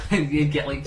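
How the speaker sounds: ordinary talk, nothing marked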